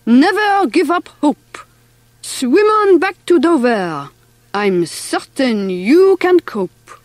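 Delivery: put-on French-accented mouse pep talk; Big Pierre, encouraging. A narrator's reading. French brother sending swimmer back to Dover, encouraging. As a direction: urge him on as a French mouse.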